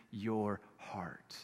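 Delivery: breathy whisper. Real, not acted. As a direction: drop to a hushed whisper. Preacher drops to whisper.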